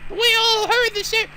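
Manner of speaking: high, slow whining complaint; high whiny voice. Acted. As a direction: whine like an annoyed girl.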